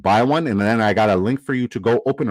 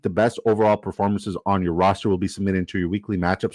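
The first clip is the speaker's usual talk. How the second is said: ordinary talk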